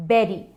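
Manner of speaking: बेरी like the end of gooseberry; short e in बेरी, "ber-ee"; correct pronunciation. Shown as a demonstration. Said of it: "pronounce करते हैं". (बेरी को गूसबेरी के "बेरी" जैसा बोलें)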